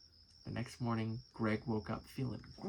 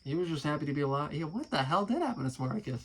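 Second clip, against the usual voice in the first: normal talking voice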